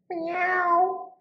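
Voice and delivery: very high, drawn-out meow; little cat. A narrator's reading. Cat answers the little witch, high meowing. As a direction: mew high like a small cat. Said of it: "the little cat said".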